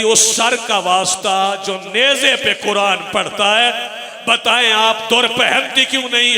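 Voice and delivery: loud, rising sermon cry; preaching at full force. Acted. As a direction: build the majlis to its peak. Preaching climax. (पूरे जोश से मजलिस का ख़िताब)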